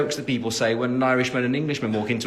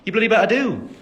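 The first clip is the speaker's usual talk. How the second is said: higher, louder Birmingham twang; Birmingham accent; 9 semitones higher, 6 dB louder than usual. Retold or imitated. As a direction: slip into Birmingham for mum. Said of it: "when my mom's here".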